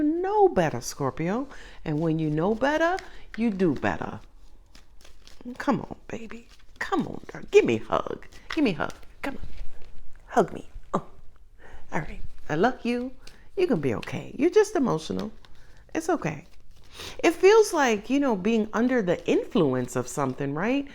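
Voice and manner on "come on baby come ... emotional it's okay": soft, coaxing tenderness, as with a hug; pretend comforting. Reader consoles an emotional Scorpio with a pretend hug, soft tenderness. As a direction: soothe them gently, playing at a consoling hug.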